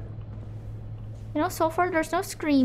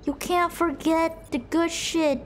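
ordinary talk

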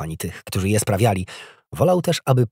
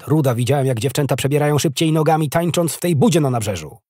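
loud, higher-pitched bellowing; sergeant's roar; 6 semitones higher, 6 dB louder than usual. A narrator's reading. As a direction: roar the reprimand across the room. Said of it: "ryknął sierżant".

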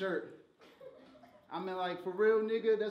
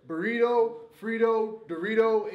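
mock Spanish lesson; 9 dB louder than usual. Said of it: "He said".

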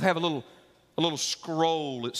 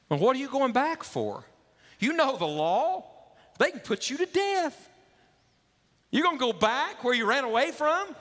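can't believe he's going back, warn him of death; alarmed stranger; 11 semitones higher than usual. Acted. Stranger warning Onesimus he could be put to death, high and alarmed.

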